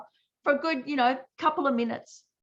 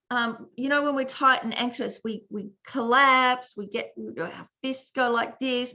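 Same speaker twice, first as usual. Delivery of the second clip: ordinary talk, nothing marked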